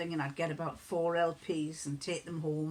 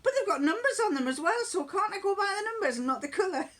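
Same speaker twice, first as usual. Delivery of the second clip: protesting son; 12 semitones higher, 4 dB louder, faster than usual. Retold or imitated. Son arguing about colour-coded books.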